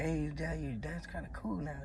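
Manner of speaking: very soft, offhand; mild homie greeting. Retold or imitated. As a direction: toss it off quietly.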